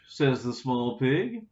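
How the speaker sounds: plain reading voice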